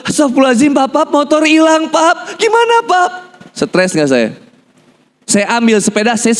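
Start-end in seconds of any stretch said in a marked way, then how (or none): 0.1-3.1 high-pitched imitation of a wife in panic; panicking wife. Wife tells husband the motorbike is gone, panicked. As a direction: act out a wife's alarm, climbing higher. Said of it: "Istri saya tiba-tiba keluar".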